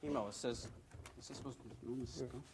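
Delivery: half-whispered off-mic aside; quiet aside. Real, not acted.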